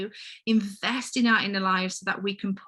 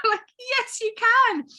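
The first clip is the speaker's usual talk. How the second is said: high, teary laugh, then louder; emotional laugh of a proud mother; laughing through tears; 13 semitones higher, 4 dB louder than usual. Real, not acted. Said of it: "oh, I'm feeling teary now".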